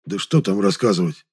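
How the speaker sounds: ordinary delivery, nothing marked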